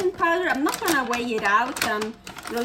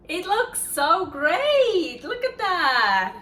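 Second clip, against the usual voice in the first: excited; 6 semitones higher, 4 dB louder, slower than usual. Real, not acted.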